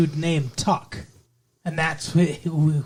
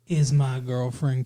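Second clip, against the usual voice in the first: usual speaking voice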